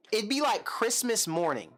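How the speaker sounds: ordinary delivery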